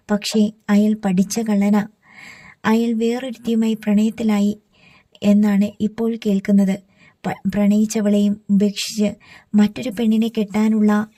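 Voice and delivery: ordinary delivery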